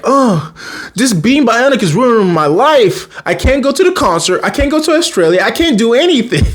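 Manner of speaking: high-pitched mock whine, climbing; imitated teen complaint. Bree complains being bionic ruins her life, hammed-up high whine. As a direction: wail a hammed-up list of grievances. Girl laments ruined life, acted.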